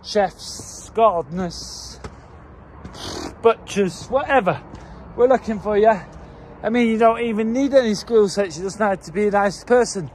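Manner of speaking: ordinary delivery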